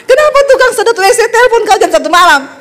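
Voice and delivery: high, rapid mock outrage; imitated wife scolding down the phone; suspicious wife's interrogation. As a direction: fire off the questions high and fast. Wife confronts the late-night caller.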